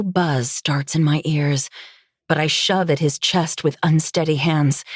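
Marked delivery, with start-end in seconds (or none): none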